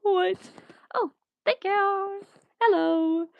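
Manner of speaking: high, loud, goofy voice that sounds like a stroke; silly squeaky voice. Pretend. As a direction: squeak it out like a cartoon. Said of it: "I think I had a stroke".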